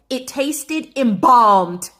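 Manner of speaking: slow, loud, punched "It tasted embalmed."; emphatic. Real, not acted. Telling viewers the grouse tasted embalmed, slow and loud. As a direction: drag out "embalmed" in disgust.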